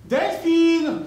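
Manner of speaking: loud high call. Retold or imitated. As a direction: shout the name across the street. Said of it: "quand je crie".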